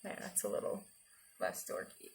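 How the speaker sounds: breathy whisper, no voice; whispered aside. Real, not acted. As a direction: whisper it like a secret.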